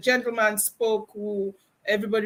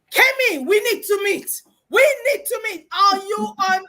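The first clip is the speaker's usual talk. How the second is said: excited and much higher, louder; urgent call; 9 semitones higher, 6 dB louder than usual. Retold or imitated. Barbara urgently calling Kemi to meet, excited. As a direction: call her over eagerly.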